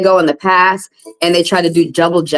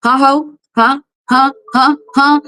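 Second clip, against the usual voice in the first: higher, pushy taunting challenge; mock confrontation; 6 semitones higher than usual. Telling off the courthouse for their sudden good deals, taunting. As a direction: jab each huh at them like a dare. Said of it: "I'm gonna say it just like that".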